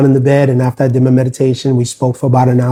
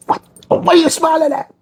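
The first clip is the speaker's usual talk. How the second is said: indignant retort. Retold or imitated. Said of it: "bull rage that day".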